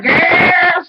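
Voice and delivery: put-on loud high yelp of girl; mock shout. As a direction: belt out girl like a startled cry. Retold woman at bingo table.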